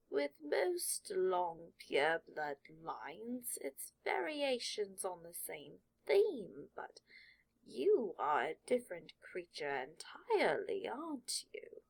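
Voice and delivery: very slow, musing ancient hat, dropping to a whisper at the end; sorting hat voice. Acted. Sorting hat studies Draco's mind, very slow and intrigued. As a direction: drawl it out, intrigued.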